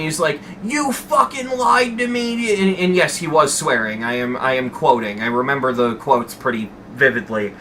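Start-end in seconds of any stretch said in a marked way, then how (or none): 0.6-2.6 high-pitched retold boss's yell, drawn out; mock angry yell. Boss yelling at an employee over a supposed lie, mock yell. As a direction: imitate a boss yelling an accusation. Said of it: "he's yelling at me".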